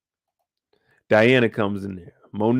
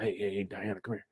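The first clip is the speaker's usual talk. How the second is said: sly hushed pitch; 5 semitones lower, 10 dB quieter, faster than usual. Retold or imitated. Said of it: "talking about".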